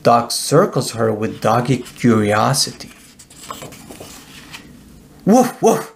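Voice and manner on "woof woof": two high, playful woofs; friendly dog barking; dog barks. A narrator's reading. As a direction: bark like an eager little dog. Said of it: "He barks and stares".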